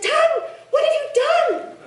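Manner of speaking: high, panicked plea from the scared body parts; frightened cry. A narrator's reading. Frightened body parts pleading with the tongue, high and panicked. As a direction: cry out in alarm.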